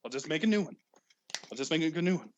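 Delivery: usual speaking voice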